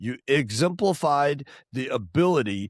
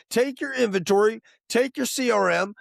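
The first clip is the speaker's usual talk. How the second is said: ordinary talk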